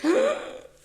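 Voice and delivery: a sudden high mock gasp; imitating her own gasp at the movie. Retelling crying at a movie, a mock gasp. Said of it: "and I'm just like".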